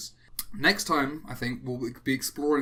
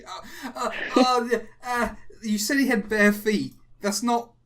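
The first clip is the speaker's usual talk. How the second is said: higher and louder, aghast; excited; 8 semitones higher, 8 dB louder than usual. Real, not acted. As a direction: gush in amused horror at it. Reacting to a horrifying drawing.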